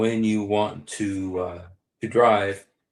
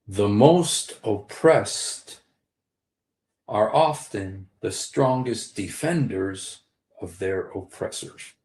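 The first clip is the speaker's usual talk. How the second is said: slow, weighty delivery of the phrase; slow emphatic maxim; slower than usual. Shown as a demonstration. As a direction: lay each word down slowly like a maxim. Repeating his key phrase.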